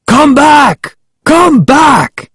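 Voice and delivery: high, strained angry shout; angry yell, far up in pitch; furious shouting. A narrator's reading. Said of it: "Ahmed shouting furiously".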